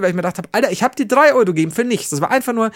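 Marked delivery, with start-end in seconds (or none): 0.5-2.1 retold annoyance. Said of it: "Weil ich so pisst wurde".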